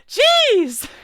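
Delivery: jeez yelled high and loud; exasperated shout. Real, not acted. Fed up with a crime tool, high loud jeez. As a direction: throw up your hands and yell it.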